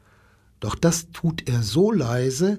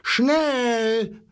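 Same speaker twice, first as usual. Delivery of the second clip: animals clamouring; 9 semitones higher than usual. A narrator's reading. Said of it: "schrien die Tiere".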